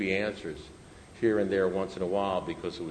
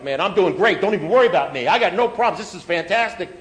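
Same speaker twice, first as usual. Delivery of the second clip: loud, high imitation of breezy bragging; mock overconfidence; 7 semitones higher, 9 dB louder than usual. Sponsored guy assuring his sponsor he's great, mock cocksure. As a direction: imitate a guy loudly insisting he's fine.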